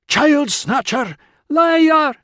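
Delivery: slow, drawn-out high shouts; accusing yell. A narrator's reading. Mob drowning out the accused man's pleas, yelling high. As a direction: hurl each accusation at full pitch. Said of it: "fists and boots and elbows and yelling".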